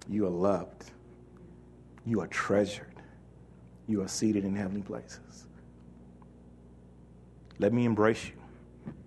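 gentle voice of God. Acted. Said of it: "What's he saying?".

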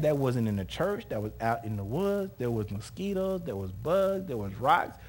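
usual speaking voice

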